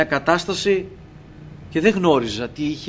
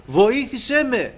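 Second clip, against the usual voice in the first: higher, slow mock cry from a hole; calling for help; 7 semitones higher, much slower than usual. Fallen in a hole, calling to the Lord in a higher, slowed mock cry. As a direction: plead upward, drawn out, as if calling up out of a pit. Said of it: "φωνάζω τώρα από την τρύπα".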